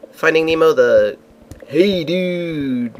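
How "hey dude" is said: a hammed-up Australian surfer drawl, lightly done; mock Aussie turtle saying hey dude; bad Australian accent. Retelling the Nemo ride, imitating Crush in a bad Australian accent. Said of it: "That's my bad Australian accent".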